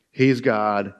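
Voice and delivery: normal talking voice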